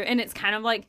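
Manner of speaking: ordinary delivery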